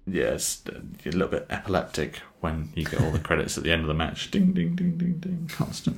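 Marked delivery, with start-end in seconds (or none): 4.3-5.5 high whispered ding ding sounds; imitated chime dings. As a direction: whisper the chimes like a game jingle. Mimicking end-of-match credit chimes.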